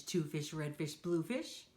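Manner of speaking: usual speaking voice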